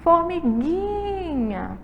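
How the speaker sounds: Formiguinha drawn out slowly on a high GUI; stress on GUI. Shown as a demonstration. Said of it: "a sílaba tônica é o gui". ("Formiguinha" devagar, demorando no gui)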